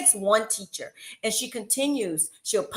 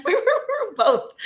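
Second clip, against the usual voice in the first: higher and slower, we were both through a laugh; 13 semitones higher, 6 dB louder, slower than usual. Real, not acted. Teacher to host, cracking up at the memory. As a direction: giggle your way through it.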